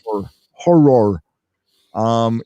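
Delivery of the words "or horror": "horror" slowly in two syllables, HOR-ROR; careful correct pronunciation. Shown as a demonstration. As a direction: draw out horror so both syllables are heard. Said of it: "instead of".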